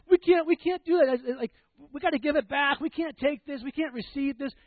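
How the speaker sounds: high, loud mock-weepy voice; imitated tearful protest. Pastor acting his crying companion refusing the gift, mock tearful. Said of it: "she's just crying".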